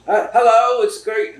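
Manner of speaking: high, loud mock British greeting.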